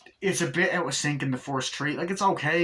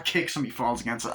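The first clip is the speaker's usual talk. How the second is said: usual speaking voice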